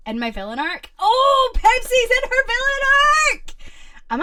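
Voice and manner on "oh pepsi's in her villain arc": much higher, loud squeal of glee; delighted shout. Real, not acted. Streamer cheering that Pepsi is in her villain arc. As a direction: cheer a friend's news.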